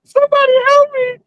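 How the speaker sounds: shrill high falsetto cries for help; fluttering cries for help. Retold or imitated. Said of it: "he sounds just like that".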